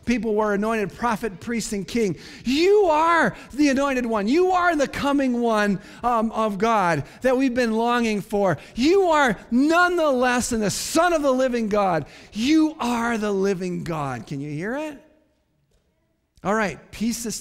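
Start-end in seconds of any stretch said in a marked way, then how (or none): 2.4-14.3 raised-voice proclamation, "You are" leaning hard; emphatic. Acted. Peter confesses the living God, emphatic on every "You are". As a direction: preach each "You are" as a ringing declaration. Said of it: "He says emphatically".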